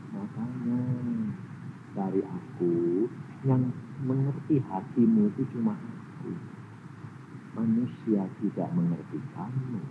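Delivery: low, soft, slow tender voice; tender God. Retold or imitated. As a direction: comfort gently. God comforting softly. (suara lembut penuh kasih)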